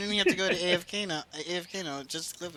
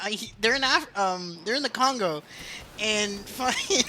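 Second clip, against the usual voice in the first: ordinary talk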